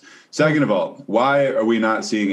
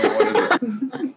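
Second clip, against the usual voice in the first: laughing; much slower than usual. Real, not acted. Laughing at hot dog joke.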